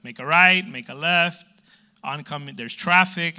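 mock the GPS calling out turns. Preacher imitating his GPS to the congregation, mock sat-nav voice. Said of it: "your G P S voice tell you".

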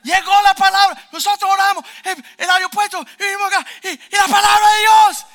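high, full-force excited yelling; frenzied high-pitched proclamation; ecstatic shouting. Retold or imitated. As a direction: shout it as if overwhelmed by God's word. Reenacts ecstatic reaction to prophecy.

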